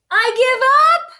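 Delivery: high, loud, fast blubbering wail; blubbering bear giving up. Acted. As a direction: sob it out like a defeated bear. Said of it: "he blubbered".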